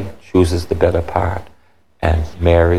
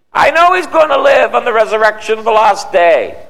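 very high, insistent cry; acted exasperated Martha, pitched way up; upset retort; 4 dB louder than usual. Preacher acting Martha answering Jesus about her brother, upset retort. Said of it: "She was upset".

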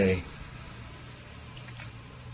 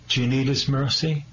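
usual speaking voice

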